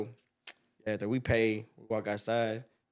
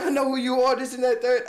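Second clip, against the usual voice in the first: mock threat; 11 semitones higher, 5 dB louder than usual. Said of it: "He said".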